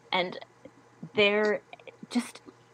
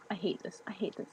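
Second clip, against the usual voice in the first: frustrated whisper; 12 dB quieter than usual. Real, not acted. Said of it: "it's just not functioning at all".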